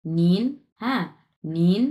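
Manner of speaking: নিন with a clear rising second tone; Chinese nín. Shown as a demonstration. Teacher models নিন for Bengali learners, rising tone. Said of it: "আমরা পড়বো হচ্ছে নিন".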